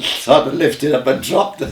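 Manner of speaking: laughing through the words. Real, not acted. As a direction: chuckle it out while telling. Laughing while recalling landmine blast.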